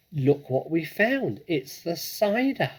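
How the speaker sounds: high, loud thrilled cry; excited cry. A narrator's reading. Small fox cries out excitedly to his dad. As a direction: cry out with delight.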